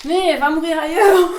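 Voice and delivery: laughing through the words. Real, not acted. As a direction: burst out laughing while speaking. Laughing mid-workout.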